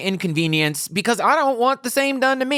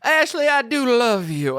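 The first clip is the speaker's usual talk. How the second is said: hammed-up Southern belle accent; Scarlett O'Hara; 3 semitones higher than usual. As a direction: play a melodramatic Southern belle declaring love. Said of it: "like a Scarlett O'Hara nightmare".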